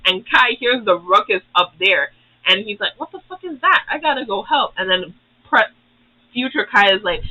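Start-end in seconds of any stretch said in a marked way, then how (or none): 3.0-4.7 high, startled outburst; mock alarmed. Kai hearing the fight upstairs, high startled outburst. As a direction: jump up in pitch, alarmed.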